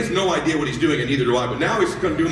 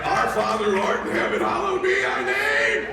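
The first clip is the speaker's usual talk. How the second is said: desperate prayer. Retold or imitated. Dad praying for ball over lake, desperate and belting. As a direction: recite it in a comic panic.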